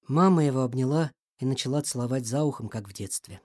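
usual speaking voice

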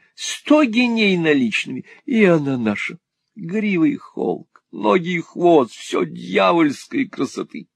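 high, drawling sales pitch; foppish enraptured husband. A narrator's reading. Husband praising the horse, high drawling rapture.

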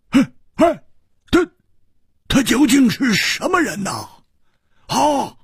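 high-pitched startled outburst; stammer, then burst out in high bewilderment; blurting out. A narrator's reading. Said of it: "蝴蝶花忍不住说". (按捺不住地惊呼)

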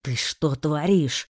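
slow, low hissing question; hissed. Acted. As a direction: hiss it at him. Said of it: "прошипел магистр".

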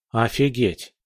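usual speaking voice

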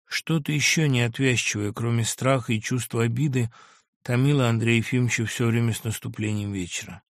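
usual speaking voice

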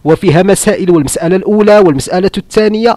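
parodying a jurist listing his points; mock scholarly recitation. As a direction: rattle off the rulings like a pompous scholar. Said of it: "حتى هو كيقول لك".

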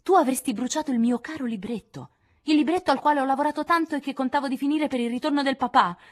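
più alta e forte, incredula; sconvolta. Acted. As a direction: ferita e indignata. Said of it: "disse Joe pallidissima". (Jo sconvolta parla ad Amy del libro perduto)

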